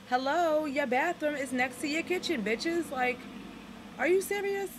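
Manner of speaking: loud, high exasperated yell; indignant shout. Real, not acted. Vlogger railing at Harlem rents, loud indignant yell. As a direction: call out the landlords, loud and high. Outrage at rent prices.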